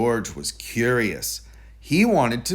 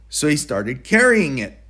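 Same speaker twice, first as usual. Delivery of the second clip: usual speaking voice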